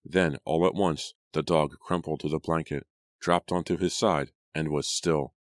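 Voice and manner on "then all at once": plain reading voice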